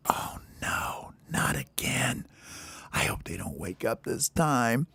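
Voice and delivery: very soft, anxious whisper; whispered dread. Acted. Baby bird hopes the others stay asleep, whispering in dread. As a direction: whisper so nobody stirs.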